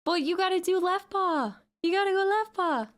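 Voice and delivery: high-pitched, playful insistence to a pet; playful urging. Pretend. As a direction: plead with the cat in a high voice. Urging the cat to give paw.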